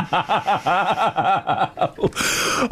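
long helpless laugh; drawn-out real laugh; real amused laughter. Real, not acted. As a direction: laugh on and on, genuinely tickled. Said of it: "it really does make me laugh".

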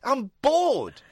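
imitating an ex-prime minister complaining; mock politician. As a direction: imitate him moaning. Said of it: "That's what he said".

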